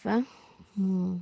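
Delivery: ordinary talk, nothing marked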